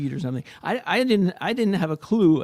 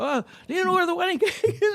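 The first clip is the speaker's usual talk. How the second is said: much higher, flustered question; flustered self. Retold or imitated. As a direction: play yourself lost and clueless. Father asking again, laughing.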